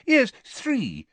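high, fussy official voice; a busy minister brushing it off; Fudge's voice. Acted. Fudge tells the Prime Minister about Dumbledore in a high fussy voice.

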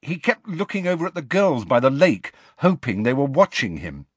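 high-pitched, insistent accusation; dogged. A narrator's reading. Harry determined to say everything on his mind, doggedly. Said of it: "said Harry doggedly".

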